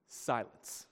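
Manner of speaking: usual speaking voice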